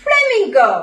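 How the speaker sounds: bright emphatic model word; clear teaching word. Shown as a demonstration. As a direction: call flamingo out high and clear for children to repeat. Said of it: "പറഞ്ഞേ".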